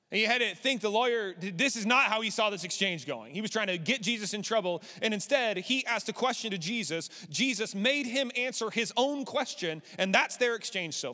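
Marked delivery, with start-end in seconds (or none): none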